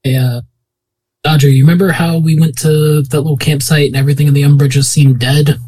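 ordinary delivery

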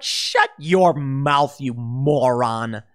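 very slow, dragged-out put-down; mock retort. Host voicing his reply to a politician, slow and contemptuous. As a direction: draw out each word with contempt.

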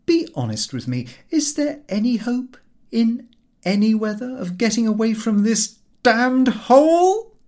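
exasperated plea. A narrator's reading. Pleading exasperated outburst.

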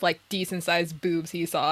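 ordinary talk, nothing marked

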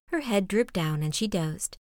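ordinary delivery, nothing marked

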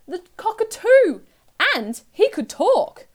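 high, loud storytelling surprise; excited reveal. A narrator's reading. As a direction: announce the last arrival with delight. Narrator reveals the final creature.

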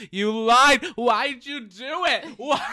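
very high, loud shriek, slow; hammed-up high yell into phone; mock yelling. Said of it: "yelling into the phone".

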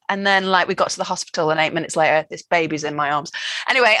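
ordinary talk, nothing marked

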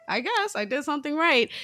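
high, pleased self-congratulation; proud relief. Retold or imitated. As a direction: lift it high, pleased with yourself.

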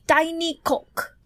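slow, lightly imitated French accent. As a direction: stretch it out in a mock French accent. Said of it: "this French accent".

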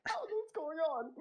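high, lost, bewildered voice; confused recruit. Retold or imitated. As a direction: squeak it out in confusion. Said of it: "I'm like".

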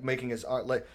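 ordinary delivery

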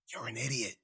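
almost silent mouthed words. Acted. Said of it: "she mouthed".

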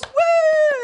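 very high hooting whoop; mock audience cheer. Studio crowd cheering the host, high mock whoop. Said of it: "then everyone goes".

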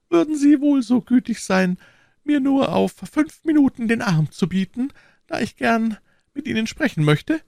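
very high falsetto lady, reproachful; offended procurator's wife. Acted. (gekränkte Frau in hoher Fistelstimme)

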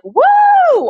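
high, loud party whoop; a crowd going wild at a street party; mock cheer. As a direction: let out a big celebratory whoo. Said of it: "they're not like".

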